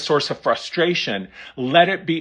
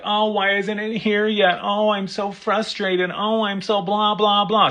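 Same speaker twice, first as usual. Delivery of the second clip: high, whiny complaining; mock whining; 6 semitones higher than usual. Mocking the impatient seeker, high whiny complaining. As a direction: whine like you're fed up waiting. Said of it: "Stop whining".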